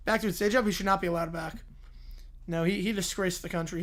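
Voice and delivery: ordinary talk, nothing marked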